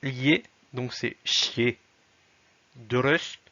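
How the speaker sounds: chier stretched out very slowly; slow syllable demo. Shown as a demonstration. Teacher slows down to show the chier syllable. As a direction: draw out chier. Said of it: "Le E, c'est".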